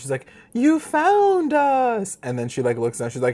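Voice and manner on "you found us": high, loud and hugely drawn out; stretched-out high mock exclamation; theatrical retold cry. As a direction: mimic an overdramatic cry, stretching every word. Retold dramatic character's cry.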